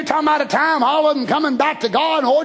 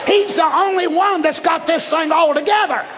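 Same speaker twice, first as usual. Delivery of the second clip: fiery preaching; 7 semitones higher, slower than usual. Acted. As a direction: preach it with full fire. Preaching at full force.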